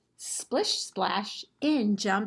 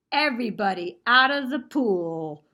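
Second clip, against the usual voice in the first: calling out; 3 semitones higher, 7 dB louder than usual. Acted. Calling out to everyone.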